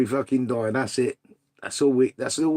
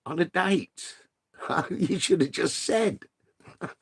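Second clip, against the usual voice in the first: laughing; 5 semitones higher, 3 dB quieter than usual. Real, not acted. Teasing reply, laughing.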